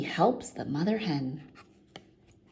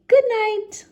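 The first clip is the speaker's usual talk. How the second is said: Barney goodnight; 10 dB louder than usual. A narrator's reading. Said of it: "Barney says".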